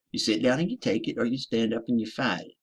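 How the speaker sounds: ordinary talk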